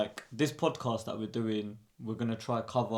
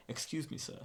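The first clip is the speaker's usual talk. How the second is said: mock posh Queen's English accent, soft; a light imitation of the Queen's accent, soft and polite; 4 semitones higher, 12 dB quieter than usual. Imitating his old Queen's accent to his cousin, softly. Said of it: "I used to have the Queen's accent".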